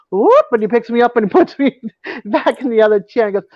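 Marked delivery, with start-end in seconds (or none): 0.1-0.5 high, louder "whoop"; imitated lifting whoop. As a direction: mimic the sound of hoisting someone up.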